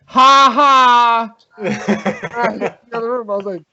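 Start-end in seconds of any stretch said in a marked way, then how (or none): 0.0-1.3 loud, high mock 'ha ha'; freaked-out fake laugh. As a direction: imitate a forced laugh, pitched up and loud. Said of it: "he's a little bit more freaked out".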